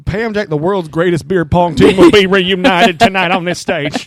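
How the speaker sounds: big loud ring announcer. Pretend. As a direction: hype it like a showman. Hyping a beer pong team.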